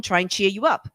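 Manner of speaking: ordinary talk, nothing marked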